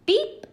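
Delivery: very high, loud beep; mock timer beep. Larry beeping at Stanley like a timer, high and loud. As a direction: buzz like a timer going off.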